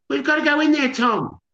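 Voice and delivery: high-pitched, panicked mother's plea; urgent plea. Acted. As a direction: plead urgently with your husband. Mother panics over missing son.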